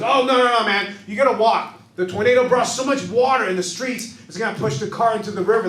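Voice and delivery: a cabbie yelling that you have to walk; urgent taxi driver. Retold or imitated. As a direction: shout the flood warning at him.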